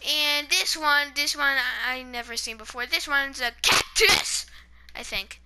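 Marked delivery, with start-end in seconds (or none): 3.6-4.5 CACTUS yelled out loud; excited shout. Real, not acted. Kid showing blocks to viewers, excited shout. Blurting out block guesses on camera.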